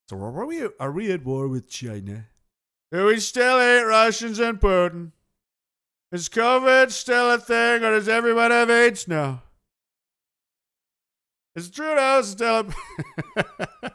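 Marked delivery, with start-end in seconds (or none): 2.9-13.9 loud, higher, slow mock bewilderment; mock loud questions. Newly returned soldier demanding news, loud and slow.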